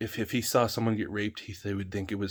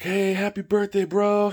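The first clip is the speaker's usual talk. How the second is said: high, loud mock buddy greeting; friend's greeting; 11 semitones higher, 6 dB louder than usual. Friend arriving at the birthday party, high loud greeting. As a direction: belt it out like a pal at the door. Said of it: "he's like".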